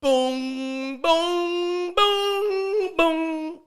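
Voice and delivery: high, sung doorbell tones; imitated door chime. Comedian mimicking the ring at his door, sung chime. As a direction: ring out long, loud held notes. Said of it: "That's my ring".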